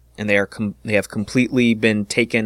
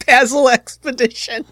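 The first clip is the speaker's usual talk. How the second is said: amused squeal; 5 dB louder than usual. Real, not acted. DM squeals name back.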